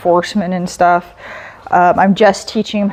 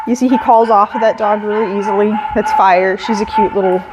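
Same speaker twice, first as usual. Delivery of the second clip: usual speaking voice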